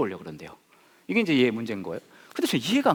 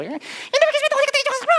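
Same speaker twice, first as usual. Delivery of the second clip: shrill high mock scolding mom, louder; nagging mother; 5 dB louder than usual. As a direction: shriek like a fed-up Korean mom. (딸을 꾸짖는 엄마, 높고 날카롭게)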